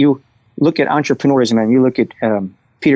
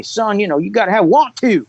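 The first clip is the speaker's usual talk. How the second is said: higher, mock coach drawl on "Son, you know"; Roy Williams drawl; 8 semitones higher than usual. Imitating coach Roy Williams yelling at a player, mild drawl. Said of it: "in that drawl".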